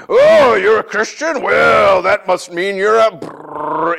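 slow, loud sneering taunt; slow loud scoffing jeer; mocking critic. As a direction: drag it out with scorn. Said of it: "Facebook hasn't helped".

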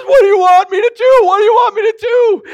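high, loud, fast screaming; frantic tearful shriek; panicked pleading. Acted. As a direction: beg the ghost in a high-pitched panic. Panicked man pleading with ghost.

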